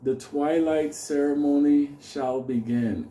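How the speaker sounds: slow, drawn-out ceremony proclamation; mock-solemn master of ceremonies; ceremonial announcer. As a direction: intone it slowly like opening a secret ritual. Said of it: "he just gets up and he goes".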